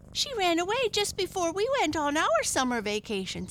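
put-on high-pitched eager boy; excited boy. A narrator's reading. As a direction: explain high and eagerly, as a child. Boy excitedly explains to mother.

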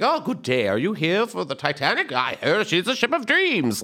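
mock upper-class greeter; posh Titanic passenger. Theater kid in costume greeting moviegoers, mock posh. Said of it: "And we'd be like".

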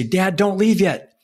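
lightly voiced young boy, pleading; son pleading. Father retelling his eight-year-old son begging him not to leave, a light hint of a young boy's plea. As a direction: echo a little boy begging his dad to stay.

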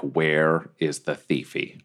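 low, slow, threatening question; menacing demand. Acted. [name] choking Riker, a low slow menacing demand. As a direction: grip him and growl it out slowly. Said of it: "I'm like".

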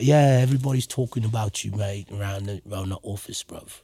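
put-on British accent. Rapper quotes the record-label A&R man's praise in a British accent. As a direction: do Jerome's relaxed British accent, bruv. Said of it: "he had, like, a, a British accent".

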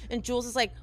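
ordinary delivery

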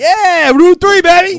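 loud, high hammed-up boast; hometown hype. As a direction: shout it like a proud local cheer. Hyping his hometown road.

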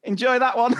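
high, teasing send-off; sing-song gloat as you walk past; smug passer-by. Retold or imitated. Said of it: "walk by going".

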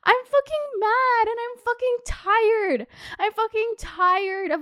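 venting, worked up and fed up; fucking mad. Real, not acted. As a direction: let the anger spill out, high and strained. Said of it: "but I am fucking mad".

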